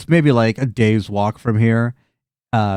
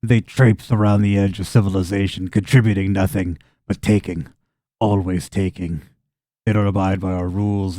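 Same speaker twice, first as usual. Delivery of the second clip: low-pitched, deliberate contempt; simple farmer, bitter; 4 semitones lower than usual. Acted. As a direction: air a farmer's grievance, measured and grim.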